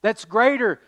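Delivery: proclaiming Moses. Retold or imitated. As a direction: announce it like a prophet. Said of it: "Moses says".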